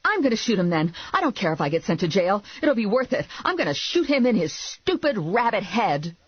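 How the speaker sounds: high, heated rant; worked-up. A narrator's reading. Driver struggling for her gun, worked-up. Furious driver vows to shoot.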